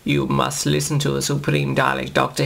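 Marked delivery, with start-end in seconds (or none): none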